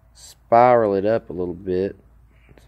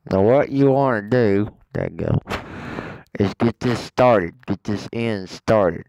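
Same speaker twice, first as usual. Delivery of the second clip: talking around a phone in the mouth; words muffled by a phone between the teeth; phone held in teeth. Real, not acted. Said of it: "I got this phone in my mouth".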